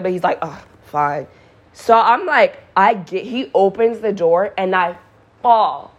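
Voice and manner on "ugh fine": softer, understated grudging sigh, imitated; mildly exasperated. Friend agreeing to open the door for drunk neighbor, mildly exasperated. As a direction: give in quietly with slight annoyance. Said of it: "he's not, like, that obnoxious with it".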